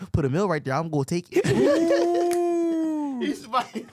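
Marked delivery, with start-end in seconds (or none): 1.5-3.2 loud high laughing shout. Real, not acted.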